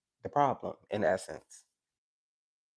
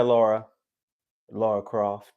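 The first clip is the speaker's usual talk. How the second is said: normal talking voice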